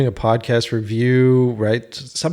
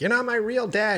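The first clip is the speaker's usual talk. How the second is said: put-on snarky kid; 10 semitones higher, 6 dB quieter than usual. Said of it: "some snarky comment".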